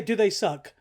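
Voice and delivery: ordinary talk, nothing marked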